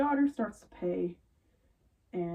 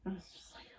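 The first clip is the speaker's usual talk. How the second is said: resigned sigh; 18 dB quieter, faster than usual. Retold or imitated.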